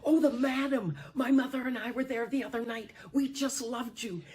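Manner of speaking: put-on high, fast, thrilled young man; squealing with delight; gushing fan. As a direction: gush like a star-struck fan. Star-struck young doctor gushing.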